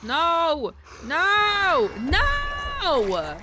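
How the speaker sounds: high, loud terrified cries; panicked no. Real, not acted. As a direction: shout it as it grabs you. Caught by a monster.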